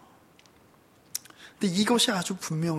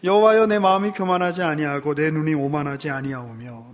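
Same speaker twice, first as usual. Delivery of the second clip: scripture reading; 2 semitones lower, 5 dB louder than usual. Acted. Reading the psalm.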